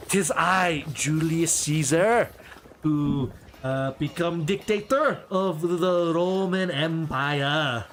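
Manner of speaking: put-on slow, grand echoing French-accented proclamation; French Julius Caesar. A French actor playing Caesar proclaims to the crowd in a French accent. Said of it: "a French guy playing Julius Caesar".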